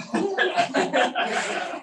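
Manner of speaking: high sing-song goodbye, cracking into laughter; laughing high-pitched goodbye ending the text. Retold or imitated. Mock-saintly text farewell, laughing.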